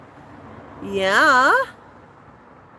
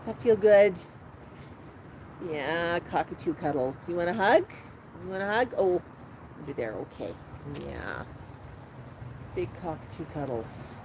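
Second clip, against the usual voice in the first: very low, soft cooing to a pet; tender murmuring; 7 semitones lower, 9 dB quieter than usual. Real, not acted. Owner hugging a big cockatoo, tender low murmur. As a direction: murmur lovingly, barely above a hush.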